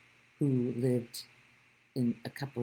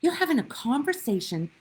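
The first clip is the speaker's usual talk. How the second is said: retold angry rebuke; 3 semitones higher, 6 dB louder than usual. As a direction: scold her like an irate neighbour at the pool. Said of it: "Yeah, he was angry".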